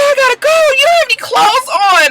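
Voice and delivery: very high acted protest; tearful panic. Telling the naked man she's leaving, tearful panic. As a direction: panicky squeal, re-enacting herself. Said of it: "the tears started to come".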